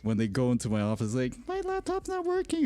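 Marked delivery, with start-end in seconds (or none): 1.5-2.6 put-on much higher, squeaky kid voice. Student asking the IT guy for help, high kid voice.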